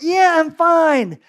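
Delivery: high, slow, loud dazed answer; dazed reply. Retold or imitated. Preacher retelling his dazed answer to a friend. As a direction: play it dazed but insisting you're fine. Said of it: "I went".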